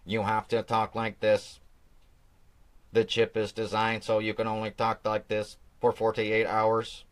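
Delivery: flat mechanical robot talk, only slightly put on. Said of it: "nothing in the chip that makes you talk like a robot".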